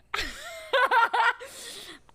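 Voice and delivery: high, loud laughing fit; breaking into laughter. Real, not acted. Player breaks character telling a silly backstory, high loud laughter. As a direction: crack up mid-story.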